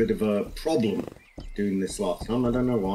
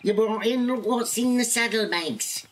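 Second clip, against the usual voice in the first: higher-pitched put-on game character voice; rustler's admiring associate; 9 semitones higher than usual. Crook congratulating the master rustler on his haul, high character voice. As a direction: play an impressed crook, pitched up.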